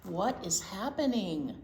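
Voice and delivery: lively picture-book read-aloud for little ones; reading to children. Acted. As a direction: tell it like a storybook to a circle of kids.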